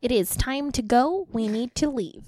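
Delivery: slow little gnome voice. Acted. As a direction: announce it slowly as a gnome. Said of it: "uh, gnome impression".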